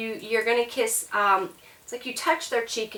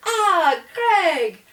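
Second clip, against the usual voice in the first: high, loud delighted hello; bright street greeting to a friend; excited greeting; 8 semitones higher, 6 dB louder, much slower than usual. Acted. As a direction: greet an old friend with a big happy cry.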